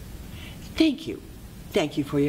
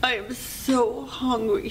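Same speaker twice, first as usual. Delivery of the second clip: put-on slow, high plaintive child voice; pitiful little girl; 8 semitones higher, slower than usual. Weeping girl answers the old lady, high slow plaintive voice. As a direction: stretch it out high, like a hungry child.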